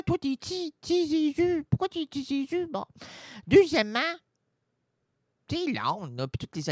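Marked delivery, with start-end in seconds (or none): 0.0-2.7 high mock plea. Mock plea to baby Jesus.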